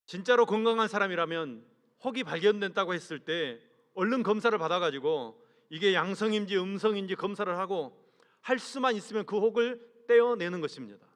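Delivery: usual speaking voice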